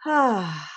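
long sighing "ah" on the out-breath; demonstrated breathing-out sigh; audible exhale. Shown as a demonstration. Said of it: "hasta hacer sonido, como".

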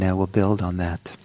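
ordinary delivery

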